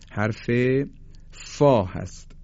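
ordinary talk, nothing marked

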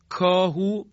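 "کاهو" with a backer k; near-correct kaf. Shown as a demonstration. Teacher points out kaf closer to correct, backer k. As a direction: stress "کاهو" with k kept back. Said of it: "به کاف درست نزدیکتره".

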